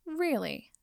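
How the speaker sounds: high, sarcastic fake amazement; mock surprise. Potter mocking Draco's boredom, mock surprise. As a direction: feign being impressed to needle him. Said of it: "in mock surprise".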